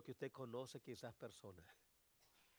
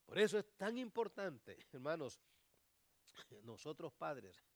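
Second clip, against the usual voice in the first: loud, much higher preaching; preaching at full force; 8 semitones higher, 14 dB louder than usual. Acted. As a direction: urge the parents with full force.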